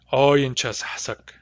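slow repeat of "Oyenchas hasak" as a single word; fuse "hasak" onto "Oyenchas"; "Oyenchas hasak" unbroken and unhurried; said as one word. Shown as a demonstration. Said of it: "synthesize those into one word".

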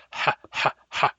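breathy puffing ha ha ha; mock huffing and puffing; huffing back H. Shown as a demonstration. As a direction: huff out the throaty H. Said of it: "huffing and puffing all the time".

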